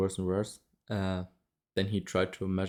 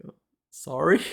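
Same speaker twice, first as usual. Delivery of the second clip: higher, louder, sheepish little sorry; patient sheepishly apologising to the stern doctor, higher; meek apology; 7 semitones higher, 5 dB louder than usual. Retold or imitated. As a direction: apologise like a scolded kid.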